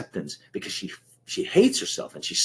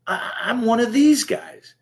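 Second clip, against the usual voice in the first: much higher and louder, slow, like a horrified realization; mock dismay; 13 semitones higher, 6 dB louder, slower than usual. Recalling standing up at his first AA meeting, mock horrified. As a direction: stretch it out in startled dismay. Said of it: "And I went".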